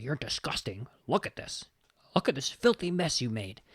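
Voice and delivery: hushed, contemptuous hiss of a harsh priest; cold scolding priest. Retold or imitated. Priest shames the boy who wet the bed, hushed and contemptuous. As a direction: sneer it down at the boy, breathy and cutting.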